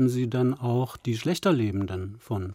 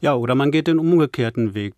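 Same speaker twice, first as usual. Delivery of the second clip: ordinary talk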